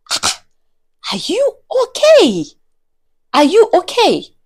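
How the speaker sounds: high-pitched incredulous question; mock bewilderment. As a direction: ask it in exaggerated disbelief. Acted baffled reply to screaming woman.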